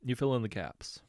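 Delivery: usual speaking voice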